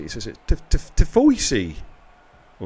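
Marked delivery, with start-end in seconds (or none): 0.7-1.8 slow Tifoisi, said tih-FOY-see, copying a friend; imitating Tifoisi. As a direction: drag out Tifoisi with an OY. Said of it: "that's how Gaza says it".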